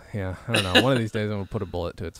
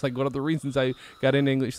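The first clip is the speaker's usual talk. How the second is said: normal talking voice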